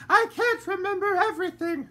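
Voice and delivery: a genteel lady fretting in the dark; voiced lady character; fussy lady. A narrator's reading. Lady Dandelion.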